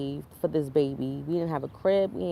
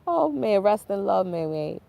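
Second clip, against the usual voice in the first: usual speaking voice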